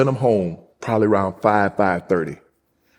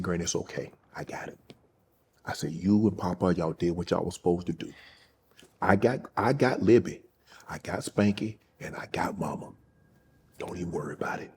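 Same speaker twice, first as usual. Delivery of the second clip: much softer, hushed comfort to a dying grandmother; tender reassurance; 2 semitones lower, 9 dB quieter than usual. Retold or imitated. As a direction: soothe her gently so she can let go.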